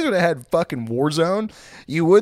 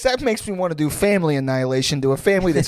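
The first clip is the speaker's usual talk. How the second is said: put-on wholesome 1950s kid; 4 semitones higher, 6 dB louder than usual. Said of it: "That's how Mormons play".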